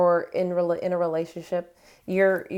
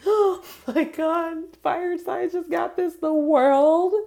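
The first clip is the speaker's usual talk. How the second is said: much higher, slow, gasping with delight; excited gasp; 11 semitones higher, 5 dB louder, slower than usual. Real, not acted. Reader gasps at The World card for fire signs, thrilled squeal.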